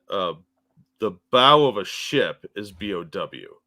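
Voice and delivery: ordinary talk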